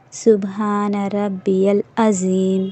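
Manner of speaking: سبحان ربی العظیم very slow, ربی joined to العظیم; slow clear tasbih. Shown as a demonstration. Teacher models correct recitation, slow. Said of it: "آپ کو عظیم پڑھنا ہے".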